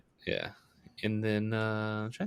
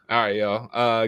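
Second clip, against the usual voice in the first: usual speaking voice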